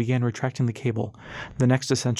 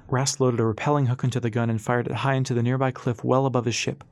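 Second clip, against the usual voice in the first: ordinary delivery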